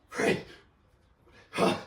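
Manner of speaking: ordinary talk, nothing marked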